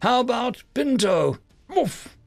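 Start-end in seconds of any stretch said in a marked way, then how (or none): 1.7-2.2 high, short bark; dog bark. A narrator's reading. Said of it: "Pinto barked".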